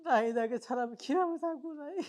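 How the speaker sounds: high, grief-stricken wail; crying. Acted. Bereaved husband asks how to live without her, crying. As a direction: weep the question helplessly. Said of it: "থাক, কান্না করে না".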